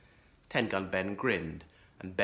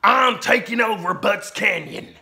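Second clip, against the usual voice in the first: growling outlaw; 9 semitones higher, 10 dB louder than usual. A narrator's reading. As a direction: sneer the insult.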